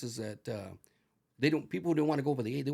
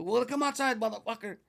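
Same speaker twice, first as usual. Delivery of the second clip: retold shouting match; 9 semitones higher than usual.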